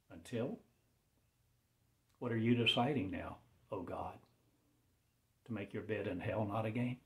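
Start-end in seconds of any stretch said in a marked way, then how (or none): none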